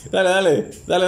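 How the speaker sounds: usual speaking voice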